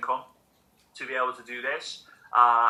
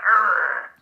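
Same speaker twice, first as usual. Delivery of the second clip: loud angry arr while scrunching paper; frustrated growl; 5 semitones higher, 10 dB louder than usual. Retold or imitated. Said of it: "He's like".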